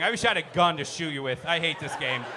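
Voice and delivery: ordinary delivery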